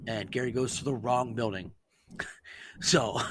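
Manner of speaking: usual speaking voice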